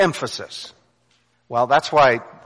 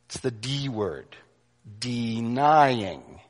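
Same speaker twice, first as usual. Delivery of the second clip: denying said slow and low, drawn out; stress on denying; 3 semitones lower, 5 dB quieter, much slower than usual. Shown as a demonstration. Preacher to his congregation, denying said slow and hushed. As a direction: lean on denying, quiet and weighty. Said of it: "what syllable does he put the accent on".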